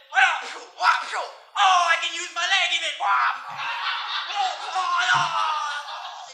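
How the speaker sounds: high, loud fight noises and cries; hammed-up action-hero whacks; fighting wheelchair detective. Ironside kicking butt from his wheelchair, hammed-up shouting. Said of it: "He'd be like".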